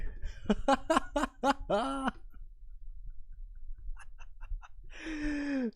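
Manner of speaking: long high laugh; high delighted laugh. Real, not acted. Laughing at own old character.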